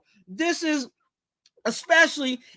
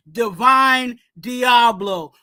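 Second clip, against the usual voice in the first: ordinary talk, nothing marked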